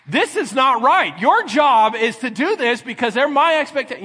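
loud, high-pitched acted complaint; indignant outburst. Husband denied his hug and kiss, indignantly scolding his wife. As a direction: lay down the law at full volume.

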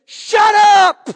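sudden furious high yell; angry shout. Retold or imitated. Kenneth Hagin rebuking the demon in a vision, furious shout. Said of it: "Kenneth Hagin gets mad".

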